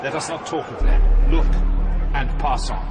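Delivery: loud, sharp dismissal; forceful command. Acted. Virgil orders Dante to pass on with a loud curt command. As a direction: cut them off with a loud command.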